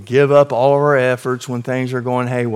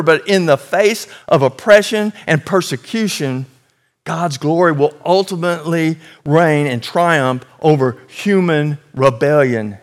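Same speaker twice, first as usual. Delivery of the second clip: ordinary talk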